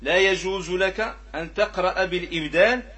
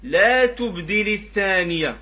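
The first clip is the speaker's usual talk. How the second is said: slow, raised, drawn-out line of didactic verse; verse recitation; 4 semitones higher, 5 dB louder, slower than usual. Acted. Teacher quoting the versifier to students, verse recitation. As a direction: recite it higher and louder, stretching the long vowels. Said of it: "لذلك قال الناظم".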